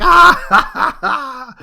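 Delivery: high, loud imitated laughing cry; mock dying cackle. Imitating a burning villain who dies laughing, mock dying cackle. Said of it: "dies laughing, dissolving into this pile".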